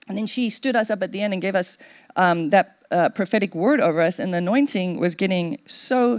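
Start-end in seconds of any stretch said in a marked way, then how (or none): none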